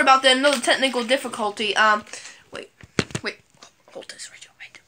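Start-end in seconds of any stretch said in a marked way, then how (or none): 2.5-4.8 very soft, hushed order; whispered aside. Real, not acted. Vlogger handing the helper something off camera, whispering. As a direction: whisper it to someone beside you.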